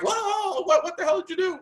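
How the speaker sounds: high, startled yelp of outrage; shocked outburst. Retold or imitated. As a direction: squeal like you just got soaked. Mock reaction to water thrown.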